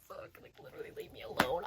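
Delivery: hushed, held-in outburst; frustrated plea under the breath; exasperated whisper. Retold or imitated. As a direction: whisper it, fed up and holding back. Acts out silent overstimulated outburst.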